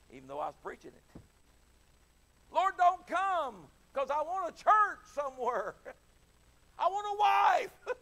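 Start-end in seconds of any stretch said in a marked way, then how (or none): 2.5-8.0 comic pleading. Retold or imitated. Young preacher pleading, shout.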